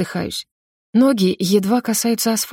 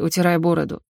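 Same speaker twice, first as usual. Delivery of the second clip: ordinary delivery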